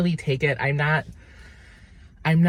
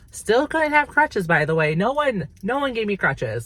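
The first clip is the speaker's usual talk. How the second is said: high-pitched indignation; 7 semitones higher than usual. Real, not acted. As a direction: squeal out the injustice. Indignant complaint about crutches.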